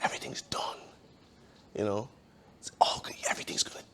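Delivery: soft, breathy doubting whisper; sly little whisper. Retold or imitated. Inner voice of doubt telling him all is lost, sly whisper. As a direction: whisper it like a sly fox in his ear. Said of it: "the little fox voice, the little whisper".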